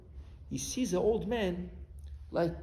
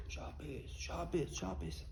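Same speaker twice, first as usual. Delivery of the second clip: barely voiced, very soft murmur; hushed, private repetition; quietly; 12 dB quieter, slower than usual. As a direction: say it under your breath. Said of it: "why do you say Shabbos kacha quietly".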